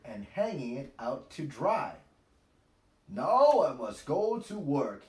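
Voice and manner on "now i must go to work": gruff, louder Daddy Pig line; a touch of a grunting father off to work. A narrator's reading. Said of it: "he grunts".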